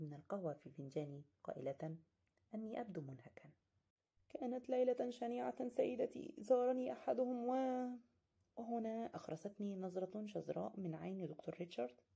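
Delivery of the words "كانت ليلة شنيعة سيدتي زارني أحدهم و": slow, drawn-out, a bit higher; weary complaint. Acted. Exhausted guest telling his landlady of a terrible night. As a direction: sound worn out after a bad night. Tired narrator tells landlady.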